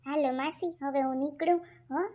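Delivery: usual speaking voice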